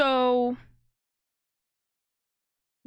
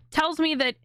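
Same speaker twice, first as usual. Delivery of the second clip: normal talking voice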